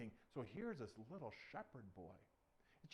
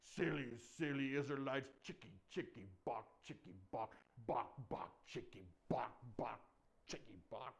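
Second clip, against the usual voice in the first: low giant voice clucking mockery; deep, sing-song chicken taunt; 4 semitones lower than usual. Giant jeering as he leaves.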